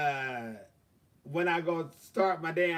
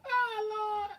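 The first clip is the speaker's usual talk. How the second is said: much higher, amused cry to the Lord; amused exclamation. Real, not acted. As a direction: toss it up high, amused. Laughing exclamation.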